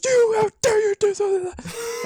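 high, loud, indignant outburst; furious confrontation. Retold or imitated. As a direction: scold him right in his face, outraged. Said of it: "gets right in his face".

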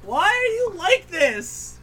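high, slow, drawn-out whine; teasing a stubborn friend, high drawn whine; exasperated whine. Real, not acted. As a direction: stretch it out, mock despair.

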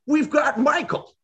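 very high, slow cartoon pig voice; Porky Pig. Porky Pig announcing to the team, high cartoon pig voice. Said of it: "Porky Pig steps up and he goes".

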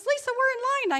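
high, loud protest; impatient husband. Retold or imitated. Husband calling his wife back to the checkout line, high and loud. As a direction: pull her back to the line, exasperated.

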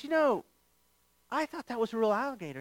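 high, quick, small-voiced confession; sheepish admission. Tourist admitting he believed the gator was real, sheepishly. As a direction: own up meekly, played for laughs.

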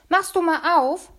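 slow, high call from the kitchen; mother calling her son to get the door. Acted. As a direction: call out to the other room, drawn out. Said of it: "rief sie".

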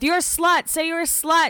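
hammed-up catty taunt; imitating a catfight insult; mock taunt. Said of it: "going back and forth".

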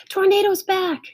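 high, joyful yell; excited yell. A narrator's reading. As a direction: yell the good news, pitch way up. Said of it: "I yelled".

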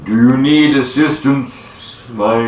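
huge, ponderous creature voice; slow-speaking giant. Acted. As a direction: draw every word out like a mountain giant. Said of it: "he speaks pretty slowly".

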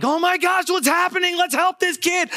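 mock panic, very high-pitched; freaking out. Showing how Jesus does not react to the seizure, mock freak-out. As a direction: imitate someone in a high, frantic flap. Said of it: "freaking out".

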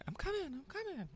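high rushing relative voice; imitating aunt. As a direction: hurry the promise in a high voice.

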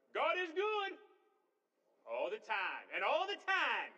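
loud, high, drawn-out church chant; mock pastor's call. Pastor rousing a congregation at service start, hammed-up call. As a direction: belt it out like a pastor rousing the pews. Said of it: "pastor get up".

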